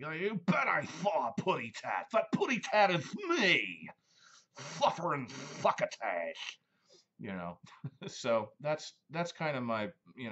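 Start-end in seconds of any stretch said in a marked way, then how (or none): 0.0-6.6 loud, higher lisping Sylvester. Retold or imitated. Imitating Sylvester singing Puddy Tat, loud lisp. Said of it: "he's like".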